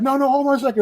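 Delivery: high-pitched retold panic; imitating a flustered scramble; frantic. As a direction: act out a frantic plea to wait. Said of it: "I'm, like, frantically".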